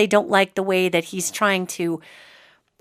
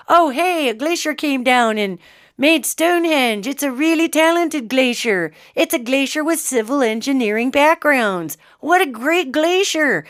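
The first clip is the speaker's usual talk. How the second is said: higher-pitched, sing-along naive wonder, sarcastic; mock amazement; 4 semitones higher than usual. Mocking the idea a glacier built Stonehenge, mock amazement. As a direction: play a gushing believer to ridicule the idea.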